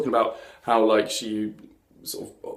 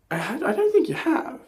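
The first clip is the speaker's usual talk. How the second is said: sceptical retort; 12 semitones higher than usual. Retold or imitated. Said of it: "And I'm like".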